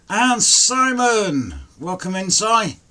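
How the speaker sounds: excited greeting. Real, not acted. Welcoming a viewer who just joined the stream, excited drawn-out call. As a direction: stretch his name out like a cheer.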